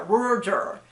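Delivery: "Roar Juror" blurred into mush; "Roar Juror" as an unintelligible run of r sounds; mushed together. Shown as a demonstration. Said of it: "no one could understand".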